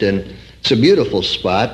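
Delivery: ordinary delivery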